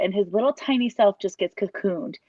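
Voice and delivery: normal talking voice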